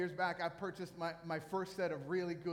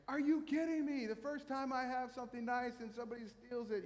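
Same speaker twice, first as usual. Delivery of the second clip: retold angry outburst; 7 semitones higher than usual. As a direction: replay the frustration, pitched way up. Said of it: "I was so angry".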